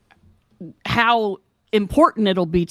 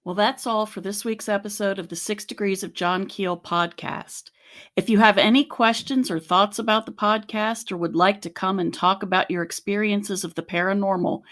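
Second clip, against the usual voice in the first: measured, scripted sign-off; composed end-of-show announcement; podcast outro read; 3 semitones lower, 5 dB quieter than usual. Acted. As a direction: read the closing announcement evenly and clearly.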